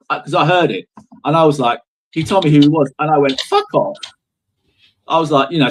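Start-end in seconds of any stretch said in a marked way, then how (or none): 3.4-4.0 high, sharp dismissive snap; mock rude brush-off. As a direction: tell him to get lost, rudely. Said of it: "Like, really rude".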